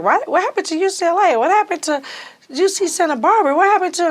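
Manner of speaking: counselor to teen, imitated with high disbelief; incredulous counselor. Counselor questioning her college choice.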